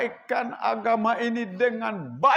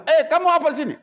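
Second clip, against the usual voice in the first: loud, higher snapping back; harsh retort; 8 semitones higher, 7 dB louder, much faster than usual. Pretend. What if Mus'ab shouted back, loud retort. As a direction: answer hardness with hardness. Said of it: "balas dengan keras juga".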